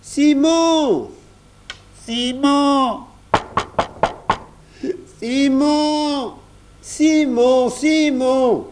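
very high, long-held shouts of a name; high, sustained childlike calls; calling out. A narrator's reading. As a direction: holler for someone between knocks. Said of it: "recommencèrent à taper et à appeler Simon".

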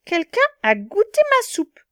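high, loud grumbling bear voice; grumbling middle bear. Acted. Middle bear finding her soup tasted, high loud grumble. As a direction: grumble it in a squeaky mama bear voice. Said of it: "Le moyen ours grogna".

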